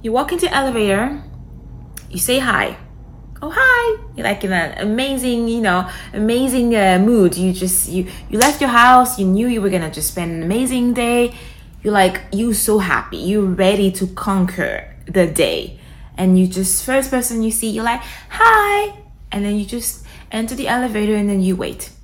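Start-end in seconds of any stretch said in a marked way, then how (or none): 3.4-4.0 bright, high, eager greeting; cheerful hi. Pretend. Greeting a stranger in the elevator, upbeat and high. 18.3-19.0 high, louder eager hello; happy hi. Pretend. Greeting the first person of the day, high and cheery.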